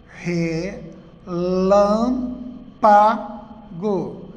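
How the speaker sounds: "Relâmpago" very slowly split into re, lâm, pa, go; syllable by syllable. Shown as a demonstration. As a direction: leave a pause after each syllable of "Relâmpago". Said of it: "A separação". ("Relâmpago" bem devagar, separado: re - lâm - pa - go)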